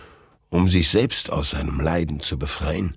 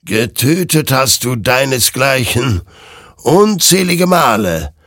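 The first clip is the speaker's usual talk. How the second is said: accusing; 7 semitones higher, 6 dB louder than usual. Acted. The creature accuses Hektor of killing his own kind. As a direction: hurl the accusation at him. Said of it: "warf die Kreatur ihm vor".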